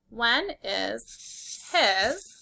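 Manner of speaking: very slow, each word drawn out; slow dictation. Shown as a demonstration. Teacher dictating a sentence slowly to the class. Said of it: "His is almost the same word".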